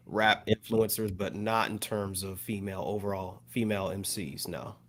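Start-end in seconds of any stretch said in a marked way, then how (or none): none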